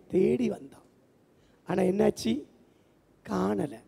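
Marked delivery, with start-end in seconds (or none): none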